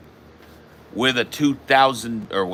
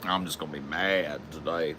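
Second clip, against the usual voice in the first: quiet sulky muttering; 7 semitones lower, 15 dB quieter than usual. Real, not acted. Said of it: "I just wanna be mad".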